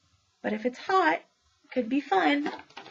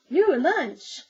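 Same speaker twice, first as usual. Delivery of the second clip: lightly voiced donkey punchline; 6 semitones higher, 6 dB louder than usual. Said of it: "the donkey says".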